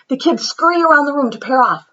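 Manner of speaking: usual speaking voice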